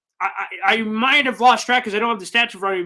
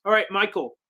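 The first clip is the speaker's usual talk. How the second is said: ordinary delivery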